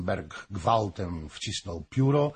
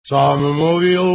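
loud, high angry outburst; 5 semitones higher, 11 dB louder than usual. A narrator's reading. Drunken Hoym defends his boast to Fürstenberg, angrily. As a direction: snap back much louder and higher. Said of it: "rzekł z gniewem".

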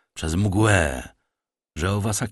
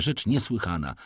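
ordinary delivery

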